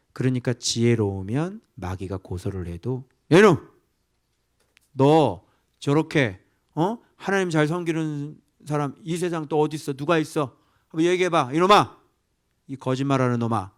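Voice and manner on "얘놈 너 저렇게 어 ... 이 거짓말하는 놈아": loud, furious scolding of a liar; angry rebuke. Retold or imitated. God rebuking the devil, loud furious scolding. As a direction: shout down the lying accuser.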